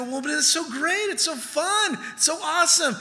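imitating someone giddy about sin; mock gushing. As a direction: gush over it like a tempted fool. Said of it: "we look at sin and go".

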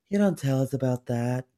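accusing line in her clipped TV tone; Camille Grammer impression. Podcast host shows her Camille Grammer bit, lightly voiced. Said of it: "I could only do Camille Grammer".

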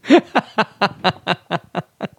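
high laugh at his own cheek; real amused chuckle; self-amused laugh. Real, not acted. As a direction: laugh at your own joke.

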